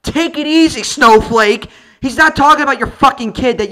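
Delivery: ranting at the wife on screen; mock angry rant. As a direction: yell back at her scornfully. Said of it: "I'm like".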